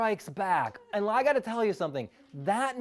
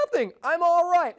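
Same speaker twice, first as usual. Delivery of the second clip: much higher, louder airy brush-off; Luke's breezy reply; 11 semitones higher, 8 dB louder than usual. Retold or imitated. Luke brushing off ground control, breezy high brush-off. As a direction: dismiss the worry with a cheery shrug. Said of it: "And you go".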